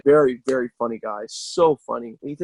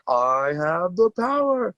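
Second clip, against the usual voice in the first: slow, higher booming hero catchphrase; He-Man; 6 semitones higher, much slower than usual. Retold or imitated. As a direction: stretch it out like a cartoon hero shouting his line. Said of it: "He-Man clips".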